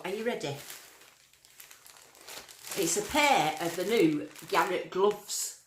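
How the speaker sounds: usual speaking voice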